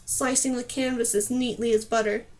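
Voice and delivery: plain reading voice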